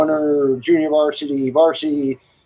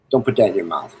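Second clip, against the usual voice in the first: stern warning; 7 semitones lower, faster than usual. Retold or imitated. Warning voiced lower.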